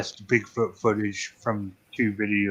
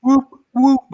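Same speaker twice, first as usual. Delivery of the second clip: loud, high hooting whoop; bigfoot whoop; 13 semitones higher, 6 dB louder than usual. Retold or imitated. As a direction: copy the silly Bigfoot call. Echoing the bad Bigfoot call.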